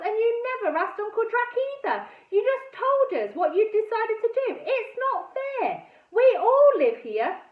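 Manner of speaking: high, loud, aggrieved outburst; indignant protest. A narrator's reading. Niece angrily blaming Aunt Tabby, indignant. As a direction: protest hotly at the injustice. Girl tells off her aunt.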